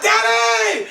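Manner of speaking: very high shout for help; high kid's cry for daddy. Retold or imitated. Beaten boy crying out for his absent daddy, high. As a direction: wail for your daddy.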